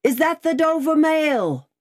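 high, sustained distant hail; calling out. A narrator's reading. Rider hails the mail coach from the mist, high calling voice. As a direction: call across the mist to a coach. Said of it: "a man's voice called from the mist".

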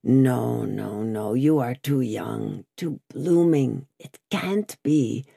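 low, slow, gloomy denial; sunken, disbelieving old prisoner. A narrator's reading. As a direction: shake it off slowly, drawing out the words. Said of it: "gloomily shook his head".

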